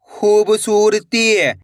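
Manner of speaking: slow, connected reading of one word; blended word. Shown as a demonstration. Teacher reading the joined word to learners, slowly blended. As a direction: run the syllables together, unhurried. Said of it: "इनको आपस में लाकर पढ़ना है".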